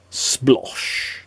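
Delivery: ordinary delivery, nothing marked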